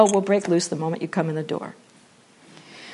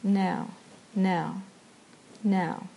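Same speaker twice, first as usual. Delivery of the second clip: calm return; 2 semitones lower, 7 dB quieter, much slower than usual. Shown as a demonstration. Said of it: "instead you just go".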